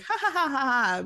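high fake "ha ha" falling in pitch; mock group laughter. Mimicking people one-upping each other, mock laughter. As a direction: imitate smug people laughing it up. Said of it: "then laughing about it".

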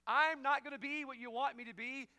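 shouted refusal, high and loud; defiant yell at God. Acted. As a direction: yell it like a stubborn runaway. Jonah refusing God, acted out.

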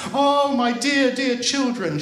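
high, fussing motherly concern; alarmed mother. Acted. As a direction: cry out in worry to the children. Alarmed mother greets crying children.

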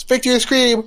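high, loud victory call; triumphant cheer. Retold or imitated. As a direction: cheer it like a win. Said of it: "my friend just goes".

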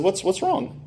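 high, soft, uneasy question; worried question. Retold or imitated. Seller asks the card dealer, mildly worried.

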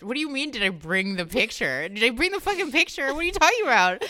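retold indignation climbing to a loud, high peak; acted outrage. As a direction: fire back questions in rising disbelief. Acts out outraged reply to doctor.

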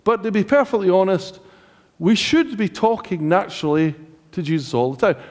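ordinary talk

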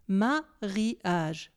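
"mariage" broken into ma, ri, age; "mariage" spelled out beat by beat: ma - ri - age; split into syllables. Shown as a demonstration. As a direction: separate each syllable of "mariage" with a short gap. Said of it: "diviser ce mot en syllabes".

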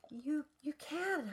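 high, soft and very slow; small voice. A narrator's reading. Annabelle answering Calvin's doubt, small voice. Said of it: "I say in a small voice".

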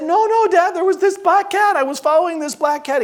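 put-on high-pitched child's insistence; imitated frantic explaining; protesting little boy. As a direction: protest to dad in a high kid voice. Boy protesting to his father.

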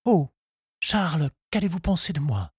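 distraught. A narrator's reading. Henriette fears for her reputation, sounding distraught. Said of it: "Elle semblait affolée".

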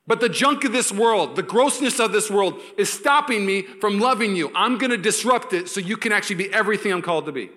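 high, loud impassioned sermon; full-force preaching. Acted. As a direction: preach it with rising passion.

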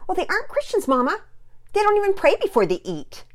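put-on high, loud girlish protest; shrilly pitched tattling objection; indignant child. A narrator's reading. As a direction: complain to mother in outraged tones. Child indignantly defends her grievance.

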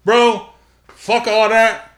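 loud, high, drawn-out dismissal; emphatic outburst. Real, not acted. Cutting off co-host's news about [name], emphatic outburst. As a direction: throw it out loudly, stretching the words.

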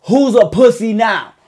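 loud, slow mocking taunt; loud gloating shooter's taunt; taunting shout. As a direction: sneer it at him as you fire. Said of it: "Went to the husband".

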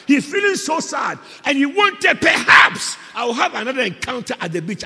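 loud, driving preacher's voice; full-force preaching. Real, not acted. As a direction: preach the story out to the whole church.

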